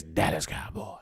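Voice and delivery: a friend's soft low mimicry.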